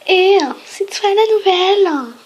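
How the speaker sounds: put-on snooty valley girl, high and slow; mean girl voice. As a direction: sneer it like a spoiled popular girl. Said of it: "je vais faire une voix de pétasse".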